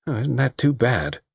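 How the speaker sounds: mildly bitter sarcasm. Acted. Spurned dance partner complaining to the world at large, mildly bitter. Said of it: "said Tony bitterly".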